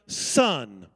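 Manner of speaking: gentle father. Retold or imitated. Father answering his rude son, gentle and low. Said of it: "in gentleness and compassion said".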